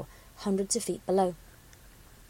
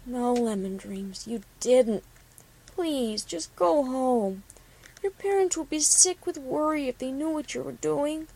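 sad; 3 semitones higher than usual. A narrator's reading. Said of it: "Copper shook her head sadly".

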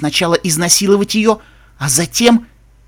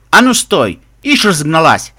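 loud, raised sharp shout; barked halt; 3 semitones higher, 7 dB louder than usual. Acted. Escort halting the prisoner on deck, loud bark. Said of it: "рявкнул конвоир".

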